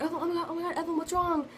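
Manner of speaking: high, worried friends' voices; worried friends. Retold or imitated. Friends seeing her cry, high and worried. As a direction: imitate friends rushing over in concern. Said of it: "all my friends were like".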